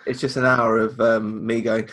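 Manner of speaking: usual speaking voice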